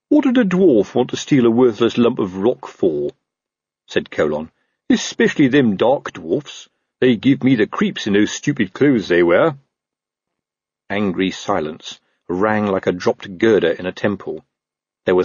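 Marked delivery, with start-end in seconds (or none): 0.1-9.6 a touch of disgruntled copper; lightly voiced grumbling watchman. Watchman complains to colleagues about dwarfs, mild grumbling.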